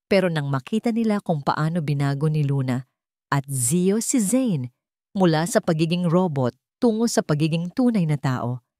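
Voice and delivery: ordinary delivery, nothing marked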